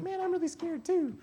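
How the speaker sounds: tiny, very high comic squeak; scared scrawny guy. Retold or imitated. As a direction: squeak it out like a frightened little guy. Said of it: "there's that little scrawny guy".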